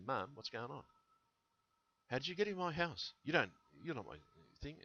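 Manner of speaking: put-on a light child voice asking mum how she got in; child pleading. Kids asking their mum how she got in, in a lightly voiced child.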